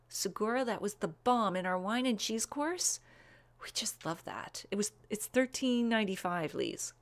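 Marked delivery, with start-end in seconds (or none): 3.6-4.7 whisper. Real, not acted. Confiding aside about favourite cava.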